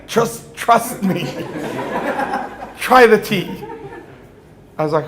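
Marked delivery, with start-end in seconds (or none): 0.1-3.6 slow words broken by giggles; laughing. Retold or imitated. Friend urging him to sip the tea, laughing. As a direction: hold back laughter while insisting.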